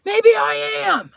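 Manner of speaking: high, drawn-out whine; mock-hurt retort. Streamer answering a viewer's homeless jab, high mock whine. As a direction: stretch it out high, playing wounded.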